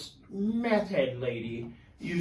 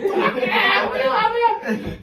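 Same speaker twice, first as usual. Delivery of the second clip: put-on high, loud whooping drunk fans; rowdy fan cheer; 8 dB louder, much slower than usual. Three rowdy fans cheering the heel, loud whooping cheer.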